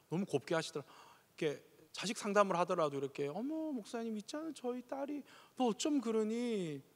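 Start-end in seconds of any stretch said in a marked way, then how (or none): 3.3-6.8 higher and much softer genteel mother's voice; soft Seoul mom. Retold or imitated. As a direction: coo it like a refined Seoul mom. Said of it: "말투가 다 이렇더라고요".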